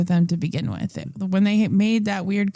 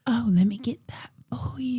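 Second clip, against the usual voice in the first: whispered seductive waitress line; breathy whisper; 4 semitones higher, 4 dB quieter than usual. Retold or imitated. Retelling the waitress who dropped to the floor, whispering.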